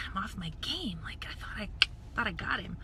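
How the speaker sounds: disappointed whisper under the breath; whispered aside. Retold or imitated. Vlogger missing the salesman's eye, whispering to herself. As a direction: mutter it to yourself, unvoiced. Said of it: "I'm all".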